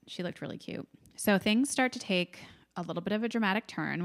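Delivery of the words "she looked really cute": admiring outfit under breath; whispered admiration. Real, not acted. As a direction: breathe it out in awe.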